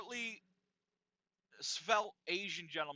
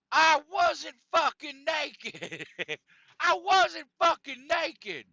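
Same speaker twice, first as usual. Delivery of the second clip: loud, high-pitched slow indignant yell; imitated ranting; 9 semitones higher, 10 dB louder, slower than usual. Sauna Santa protesting the naked accusation, imitated ranting. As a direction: rant it out, drawing out the protest. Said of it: "Sauna Santa was ranting and raving".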